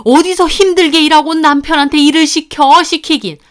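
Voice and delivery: high, loud indignant tirade; furious mother-in-law. A narrator's reading. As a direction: scold the daughter-in-law in outrage. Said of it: "그 말에 어머님 거품 물더군요". (거품 무는 시어머니)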